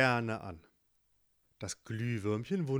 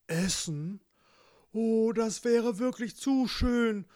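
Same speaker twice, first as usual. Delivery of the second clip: longing bear; 7 semitones higher, 4 dB louder than usual. Acted. Bear tells the fox his doubts, wistful high voice. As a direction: sigh over the food you can't have.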